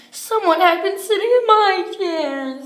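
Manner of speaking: high, small storybook bear cub; Baby Bear's wee little voice. Acted. Baby Bear finds his chair sat in, wee little voice. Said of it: "in his wee little voice".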